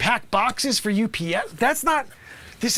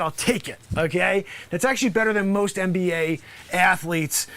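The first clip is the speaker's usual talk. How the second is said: ordinary talk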